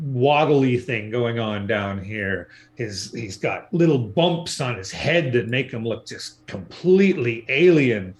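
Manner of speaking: ordinary talk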